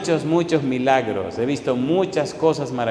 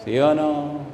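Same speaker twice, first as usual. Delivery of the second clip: ordinary talk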